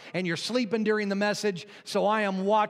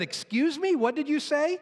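higher-pitched, indignant lady; offended lady; 8 semitones higher than usual. Acted. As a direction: ask it high, taken aback. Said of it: "She said".

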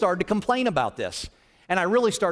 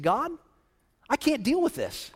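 venting at God; 7 semitones higher than usual. Retold or imitated. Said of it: "I just let God have it".

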